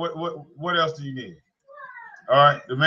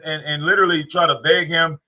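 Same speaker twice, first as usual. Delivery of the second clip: ordinary talk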